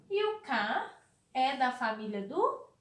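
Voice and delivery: ordinary talk, nothing marked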